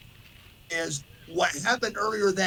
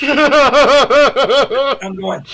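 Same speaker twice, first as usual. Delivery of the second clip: laughing high; 8 semitones higher, 13 dB louder than usual. Real, not acted. Mimicking valley girl walk.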